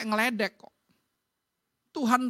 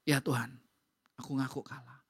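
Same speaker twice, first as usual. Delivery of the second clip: low, soft surrender; acted humble admission, fading softer; conceding defeat to God; 10 semitones lower, 6 dB quieter than usual. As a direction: give in quietly, pitched right down. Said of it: "Tapi kalau kalah, fair".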